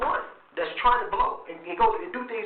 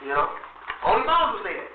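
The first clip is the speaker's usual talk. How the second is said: normal talking voice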